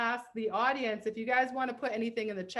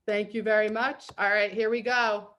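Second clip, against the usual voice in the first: usual speaking voice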